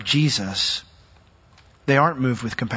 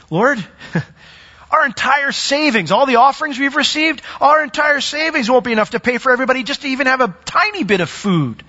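fretting disciple; 10 semitones higher than usual. Acted. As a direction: play the worried money man, half laughing. Philip panics over money.

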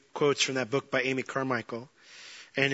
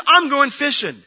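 I'm going fishing higher and louder, weight on I'm; emphatic quote; 10 semitones higher, 7 dB louder than usual. Retold or imitated. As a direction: declare it to the room. Preacher quotes Peter again.

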